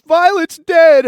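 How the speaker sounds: high, loud, drawn-out imitated wail; hammed-up hysterical caller; mock crying wail. Crying woman calls to report a death, mock wail. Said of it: "she's fucking crying".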